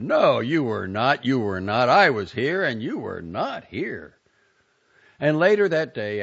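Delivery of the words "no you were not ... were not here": sharp retort. A narrator's reading. Husband retorting to wife, loud and insistent. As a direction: hammer back her excuse. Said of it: "he retorted".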